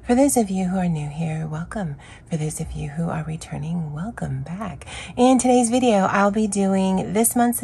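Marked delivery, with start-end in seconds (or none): none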